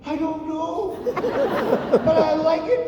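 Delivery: put-on high-pitched comic young self; mock squeaky reply to a parent; sheepish son. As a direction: answer mom in a high, unsure voice. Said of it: "I said".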